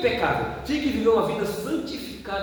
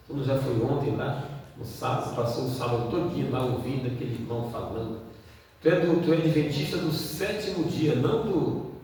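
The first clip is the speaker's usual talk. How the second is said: tempting inner voice; 6 semitones lower, 3 dB quieter than usual. Retold or imitated. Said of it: "vem uma voz que diz assim".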